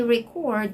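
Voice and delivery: "record" stressed on the second syllable; "record" said ri-KORD; verb stress. Shown as a demonstration. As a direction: lean on CORD in "record". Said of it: "that's already the verb form".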